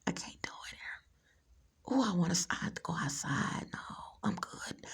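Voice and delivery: hushed whisper, holding back laughter. Real, not acted. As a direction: whisper it like in a library. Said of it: "library voice".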